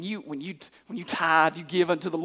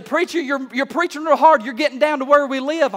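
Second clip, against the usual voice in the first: higher and louder, cheering the preacher on; a member of the congregation egging him on; mock excited churchgoer; 5 semitones higher, 4 dB louder than usual. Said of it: "You say".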